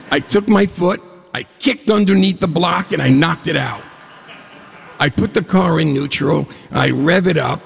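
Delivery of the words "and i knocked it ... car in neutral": ordinary delivery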